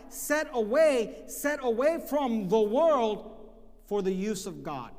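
loud, slow, ringing pulpit build; fiery sermon climax, drawn out; full-force preaching. Acted. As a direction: hammer it home to the congregation.

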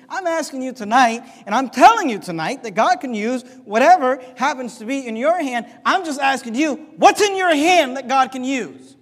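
loud, driving preacher's cadence; preaching. Acted. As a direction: preach it hard to the congregation. Preacher's appeal to congregation.

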